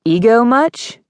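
high, rising mocking question; scornful little taunt; sarcastic jab. A narrator's reading. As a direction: needle him, pitch shooting up. Heroine taunts the arrogant boyfriend.

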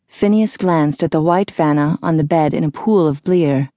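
ordinary delivery, nothing marked

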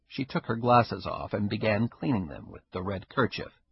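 ordinary delivery

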